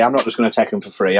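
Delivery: ordinary talk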